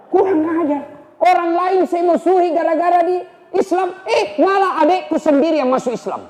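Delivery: high-pitched angry outburst; Umar's outburst. Acted. Umar learns his sister has converted, high furious outburst. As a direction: spit it out high, stung by the news. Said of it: "Wah, marah Umar".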